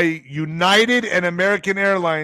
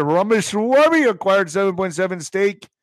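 usual speaking voice

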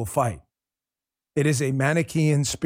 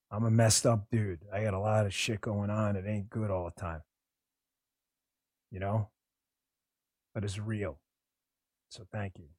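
choked up; 2 semitones lower, 7 dB quieter than usual. Real, not acted. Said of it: "Sorry, I got a little emotional there".

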